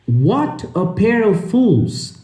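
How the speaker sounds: slow careful reading. Shown as a demonstration. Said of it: "reading the text slowly".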